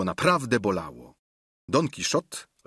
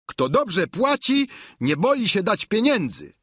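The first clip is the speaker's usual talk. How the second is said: Sancho; 11 semitones higher, 4 dB louder than usual. A narrator's reading. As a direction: answer as the squire, pitched right up. Voiced reply of Sancho. (wysoki głos giermka)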